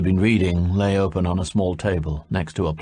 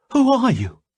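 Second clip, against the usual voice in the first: high, loud startled demand; 4 dB louder than usual. A narrator's reading. Salmataya confronting the stranger, high loud demand. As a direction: challenge the intruder. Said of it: "she demanded".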